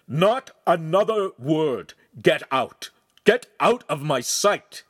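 loud, slow, high roar; ferocious bellow. A narrator's reading. Master bellowing at Ugolino to get out, loud and ferocious. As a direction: bellow him out of the room. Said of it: "The master's bellow was so loud and ferocious".

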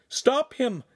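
high-pitched urgent call; crying out. A narrator's reading. Giulietta calling after the fleeing man, high-pitched cry. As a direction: cry out high to halt someone. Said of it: "he heard Giulietta cry again".

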